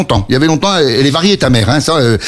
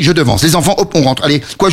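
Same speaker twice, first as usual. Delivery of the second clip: ordinary delivery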